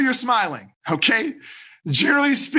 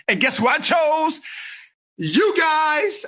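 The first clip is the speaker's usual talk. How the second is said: higher, eager reveal; animated; 6 semitones higher, slower than usual. Shown as a demonstration. Ava excitedly telling parents she chose them. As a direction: build up to the big reveal. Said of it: "I'm animated".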